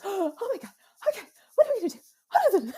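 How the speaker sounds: high, loud mock panic with a laugh; panicked mom. Mom reacting to her distressed child, high mock panic. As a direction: flap like a frightened parent. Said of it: "I was like".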